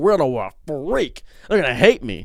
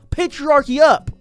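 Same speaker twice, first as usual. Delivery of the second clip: higher, louder, slow hammed-up battle cry; mock rallying cry; 10 semitones higher, 5 dB louder than usual. Macho rant persona rallying the men, mock shout. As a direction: bellow it like a parody war chant.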